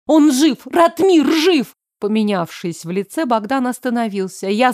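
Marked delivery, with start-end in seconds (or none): 0.1-1.7 loud, high-pitched, drawn-out accusing cry; angry outcry. A narrator's reading. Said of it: "слышалась злость". (злой, взволнованный крик)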